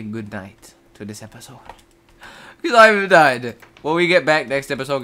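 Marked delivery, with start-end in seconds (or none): 2.6-5.0 loud, raised-pitch declaration; emphatic sign-off. Real, not acted. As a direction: blurt it out loudly, finished for good.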